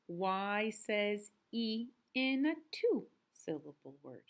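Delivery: slow, clear and loud; slow emphatic rule. Shown as a demonstration. Teacher to first graders, slow loud rule. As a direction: stress each word of the rule. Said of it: "Sing it with me".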